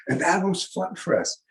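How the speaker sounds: ordinary delivery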